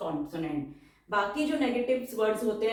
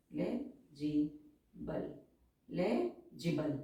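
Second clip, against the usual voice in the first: slow dictation; 5 semitones lower, 7 dB quieter, much slower than usual. Shown as a demonstration. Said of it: "teacher dictates the word".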